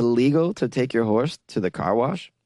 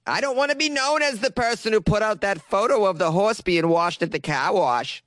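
hammed-up worried local, pitched up; anxious Boston witness; 6 semitones higher than usual. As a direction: play a Bostonian woman dreading exposure. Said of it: "she wants to hide her identity".

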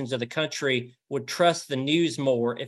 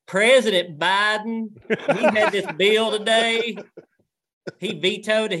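thick rural Southern accent, slow, higher and louder; heavy country Southern newsreader, slowed right down; full swap shop Southern; 7 semitones higher, 5 dB louder, slower than usual. Shown as a demonstration. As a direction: lay the Southern drawl on thick. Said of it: "full swap shop".

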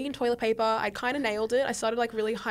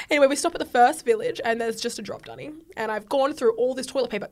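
ordinary talk, nothing marked

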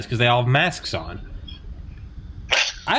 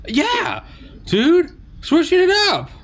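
much higher, louder mocking dude voice; mock bro voice; 4 dB louder, slower than usual. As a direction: ham up a clueless party guy. Mock reply as spring breaker.

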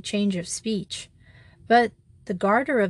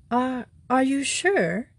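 higher, slow, hesitant little secretary; timid eagerness; 4 semitones higher than usual. A narrator's reading. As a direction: stammer it out like a meek clerk. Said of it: "with the timid eagerness of the private secretary".

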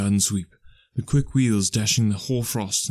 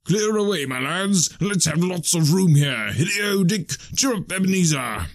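high, hearty calling; rally the lads merrily in a raised voice; jovial old master; 7 semitones higher than usual. Acted. Fezziwig wants lots of room cleared, high jovial calls.

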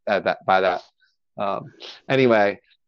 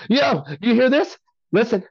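much higher, eager retold call for attention; excited bragging; 12 semitones higher than usual. Guest retelling his Snapchat brag to friends, high excited voice.

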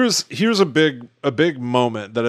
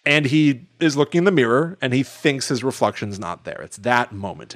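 ordinary talk, nothing marked

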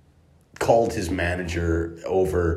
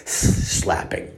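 ordinary delivery

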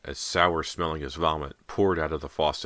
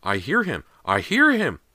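excited high shouts, building; shrill cries; 9 semitones higher, 7 dB louder than usual. A narrator's reading. Small boy bursting in about his puppy, shrill. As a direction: cry it out, louder and higher the second time. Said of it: "James's shrill cries burst into the room".

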